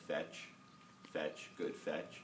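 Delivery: slow, soft, coaxing fetch; soft command. Shown as a demonstration. Trainer softly coaxing the dog during the exercise, slow and soft. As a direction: murmur it to him gently and slowly. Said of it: "I can start telling him".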